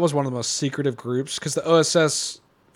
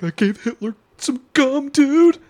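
giddy excited voice; 12 semitones higher, 6 dB louder, slower than usual. Retold or imitated. Voicing a happy soldier.

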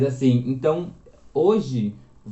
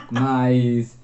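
ordinary delivery

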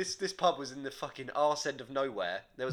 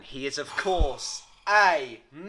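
game show reveal; 6 dB louder, slower than usual. Acted. Quiz host reveals answer.

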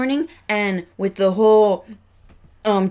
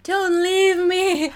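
much higher, slow clingy plea; needy rose voice; 13 semitones higher, 4 dB louder, much slower than usual. Retold or imitated. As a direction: whine it like a needy flower. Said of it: "the rose was just like".

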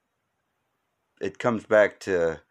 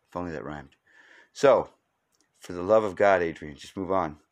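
ordinary delivery

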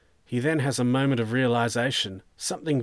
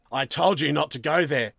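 louder, higher reprimand; scolding; 4 semitones higher, 5 dB louder than usual. Acted. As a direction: scold him for disobeying you. Sergeant scolds suspect.

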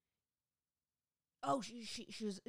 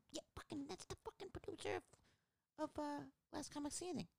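high, softer squeal of gossip; hushed excitement; 10 semitones higher, 6 dB quieter than usual. Retold or imitated. Telling girlfriend who the rude producer was, hushed excitement. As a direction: confide it in a high, keyed-up hush.

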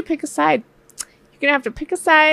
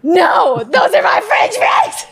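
high, slow, half-joking yell; mock shout; 4 semitones higher, slower than usual. Wife defending her fries from husband, high mock shout. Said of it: "I'm like".

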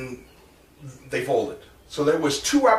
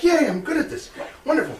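much higher, laughing self-congratulation; mock delight; 13 semitones higher than usual. Teller re-enacts cheering his own first jump, mock proud. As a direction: crow about it, half mocking yourself. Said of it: "I'm like".

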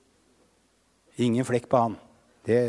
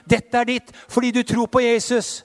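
ordinary talk

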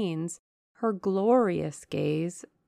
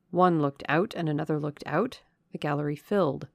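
ordinary delivery, nothing marked

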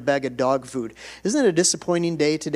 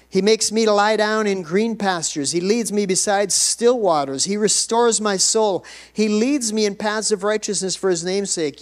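higher-pitched, emphatic preacher voice; rolling pulpit recitation; fervent scripture recitation; 5 semitones higher, 3 dB louder than usual. Real, not acted. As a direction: proclaim the psalm to the congregation.

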